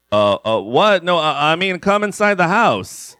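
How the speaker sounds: high, flustered backpedal. Acted. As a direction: stammer it out, rattled. Flustered correction.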